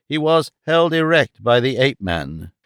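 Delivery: usual speaking voice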